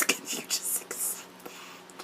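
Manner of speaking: slow, tearful whisper; whispered plea. Acted. Fan pleading with a mother to accept, whispering. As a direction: drop to a drawn-out whisper, as if crying. Worked-up plea after the outburst.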